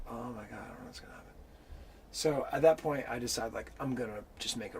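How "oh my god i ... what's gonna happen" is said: frightened whisper. Retold or imitated. Fear at gate standoff.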